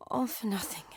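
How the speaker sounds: very soft, sighing defeat; dejected. Acted. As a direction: sigh it out quietly, giving up. Dejected after the outburst.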